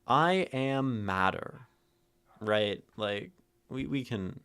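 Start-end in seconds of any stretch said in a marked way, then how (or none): 0.1-1.7 slow, high "I am matter", each word given weight; "I am matter" drawn out and raised; slow, spread-out emphasis. Shown as a demonstration. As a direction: stretch out I AM MATTER evenly. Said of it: "four ways to read it".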